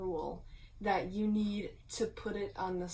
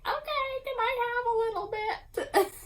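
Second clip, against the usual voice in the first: put-on much higher, louder old lady voice; 6 dB louder, faster than usual. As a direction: beg for the blanket like a little old lady. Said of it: "like, an old lady voice?".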